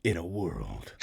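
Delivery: low, soft trailer narrator; deep dramatic movie guy. Acted. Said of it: "just do the whole movie in that voice".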